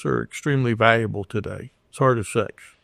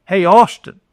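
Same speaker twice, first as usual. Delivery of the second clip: high, loud call across the lot; calling a man's name across a parking lot, friendly yell; 6 semitones higher, 7 dB louder than usual. Retold or imitated. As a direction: shout his name to make him turn. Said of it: "And I yell across".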